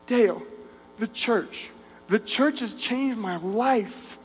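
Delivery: soft, slow, tearful voice, words broken by pauses; half-cry the words, slow and hushed; retold sobbing. Retelling Martin's words to Dale, through soft mock sobs. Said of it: "through his sobs".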